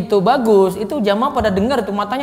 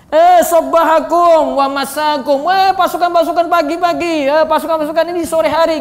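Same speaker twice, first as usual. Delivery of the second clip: high, loud commander's call; calling the troops; 5 semitones higher, 4 dB louder than usual. Shown as a demonstration. As if summoning an army for morning attack, urgent shout. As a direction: shout out a warning to soldiers. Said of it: "Lagi teriak pasukan".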